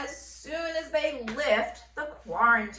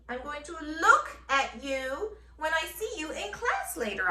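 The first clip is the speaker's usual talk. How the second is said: playful, much higher, with a laugh; 8 semitones higher than usual. Real, not acted. Teacher joking to her students about seeing them in class. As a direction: joke it through a laugh. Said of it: "teacher jokes, laughing".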